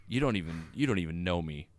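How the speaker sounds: low, soft hurt scolding; a mild mock "not cool" scold; quiet offended woman, low and soft; offended quiet rebuke. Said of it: "she just stares right at him and goes".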